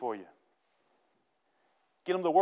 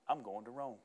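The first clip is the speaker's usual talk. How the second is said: low, hushed statement; understated, soft-spoken certainty; quiet resolve; 4 semitones lower, 11 dB quieter than usual. Acted. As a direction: say it softly, as a settled fact. Paul's resolve after the shipwreck.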